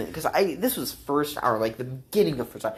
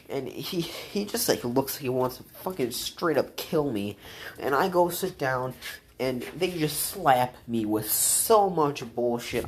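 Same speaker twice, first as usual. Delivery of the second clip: ordinary delivery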